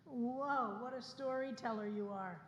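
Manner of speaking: ordinary talk, nothing marked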